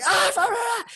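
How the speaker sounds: very high mock-Hebrew wail; crazed man shouting. Man outside arguing back, high crazed wail. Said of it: "going fucking crazy".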